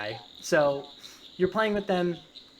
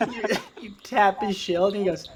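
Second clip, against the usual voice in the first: ordinary talk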